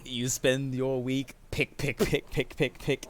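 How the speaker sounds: loud chanted pick, pick; rhythmic pick sounds. Acted. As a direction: hammer out each pick like a swing. Narrating pickaxe work rhythmically.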